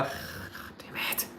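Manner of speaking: breathless whispered swear; whispered curse. Real, not acted. Streamer gives up searching, whispered curse. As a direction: hiss it under your breath. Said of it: "whispered curse".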